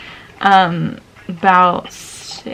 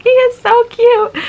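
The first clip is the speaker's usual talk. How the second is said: much higher and louder, squealing; gushing; 9 dB louder than usual. Retold or imitated. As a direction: coo over the puppy. Mom gushing over puppy.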